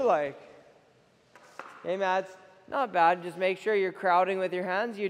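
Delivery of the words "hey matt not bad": usual speaking voice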